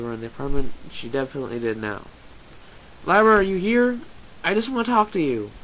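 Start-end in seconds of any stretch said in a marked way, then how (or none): 3.0-5.5 high, pleading shout through the house; raised, searching voice; louder call. A narrator's reading. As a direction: call out loudly, hoping for an answer. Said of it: "this time louder".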